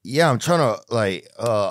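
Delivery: ordinary talk, nothing marked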